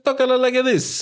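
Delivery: high hammed-up Italian-American accent; mock Italian accent. Mocking the movie's Italian accent to a friend. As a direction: ham up a cartoon Italian accent. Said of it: "that Lady and the Tramp Italian".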